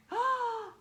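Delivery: sharp high gasp of shock; loud shocked gasp. Acted. Reader shocked at the puppy quacking, loud high gasp. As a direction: gasp loudly at the wrong sound.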